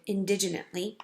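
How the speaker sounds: usual speaking voice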